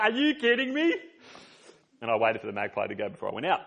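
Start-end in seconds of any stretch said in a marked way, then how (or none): 0.0-0.9 high, loud mock outrage; incredulous girlfriend. Girlfriend catching him laughing through the window, high indignant disbelief. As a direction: play the exasperated girlfriend in disbelief. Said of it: "She sees me laughing ... is like".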